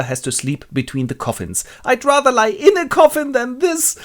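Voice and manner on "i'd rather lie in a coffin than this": put-on high, loud boy's wail; protesting orphan boy. Orphan made to sleep between coffins, high protest. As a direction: complain in a melodramatic child voice.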